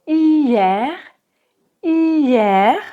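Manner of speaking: hier said with open E and final R. Shown as a demonstration. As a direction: model hier twice, open è. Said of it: "ça se prononce avec un E ouvert".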